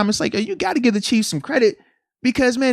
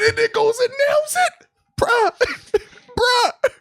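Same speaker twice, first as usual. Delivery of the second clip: amazed; slower than usual. Real, not acted. Amazed reaction to kick.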